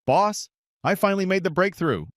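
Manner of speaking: calling out the big news to his boss. A narrator's reading. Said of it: "called out to his captain".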